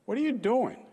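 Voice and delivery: high, loud yell. Retold or imitated. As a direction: yell it like a mom catching a kid. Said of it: "and she yelled".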